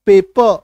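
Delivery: पेपर as "pay-puh", ending on the open vowel; semi-silent R. Shown as a demonstration. Teacher giving the actual pronunciation, पेपर without its R. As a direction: drop the final र of पेपर. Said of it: "actual उच्चारण".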